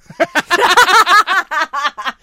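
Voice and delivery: big falling burst of laughter; loud laugh. Real, not acted. Laughing after the teacher impression.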